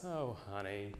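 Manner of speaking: deflated mother sighing; weary sigh. Retold or imitated. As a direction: give up with a tired exhale. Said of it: "slumps and sighs".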